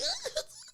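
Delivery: sudden squeaky laugh; high burst of laughter. Real, not acted. Laughing about crying on the balcony, high laugh. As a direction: let a high laugh break out.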